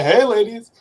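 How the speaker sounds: mock come-on, pitched high and loud; playful loud catcall; sleazy barfly pickup. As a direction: call out to women across a bar, hammed-up. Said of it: "be like".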